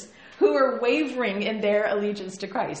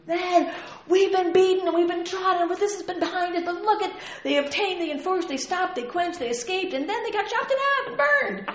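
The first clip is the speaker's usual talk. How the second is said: high, loud rallying build; coach pep talk; 9 semitones higher, 5 dB louder than usual. Retold or imitated. Coach before the big game, loud rallying speech. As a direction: fire up the team before the game. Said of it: "he's a coach before the big game".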